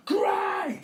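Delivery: very high, loud squawk; shouting cockerel. A narrator's reading. Cockerel delighted by the plan, high shout. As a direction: crow the word with glee. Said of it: "said the cockerel".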